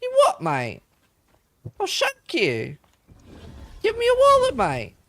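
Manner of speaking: put-on British thug accent that leaps high and loud on the wallet demand; mock British bandit. Streamer mocks game bandits in a hammed-up British thug accent. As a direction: play a dim British highwayman mugging someone. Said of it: "simpleton bandits always have a British accent".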